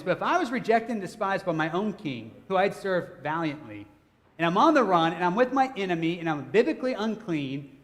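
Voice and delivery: ordinary talk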